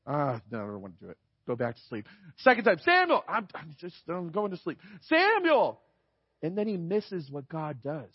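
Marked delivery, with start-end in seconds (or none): 0.1-2.1 much lower, much softer, mumbled refusal; a drowsy kid waving off the call; grumpy sleepy boy. Pretend. Imagined Samuel refusing sleepily. 2.8-3.2 calling out. Pretend. God calls a second time. 3.3-4.8 much softer, half-asleep brush-off; sleepy mumble. Pretend. Imagined Samuel refusing again. 5.0-5.8 calling out. Pretend. God calls third time.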